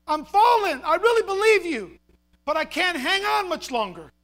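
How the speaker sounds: loud, high frantic plea; acted panicked cries to the Lord; crying out. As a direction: shout back desperately while slipping. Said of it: "The man cried out".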